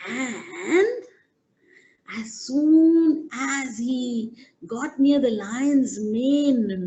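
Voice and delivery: usual speaking voice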